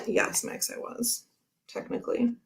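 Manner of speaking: whispered reply. Pretend. Answers son's teasing, hushed.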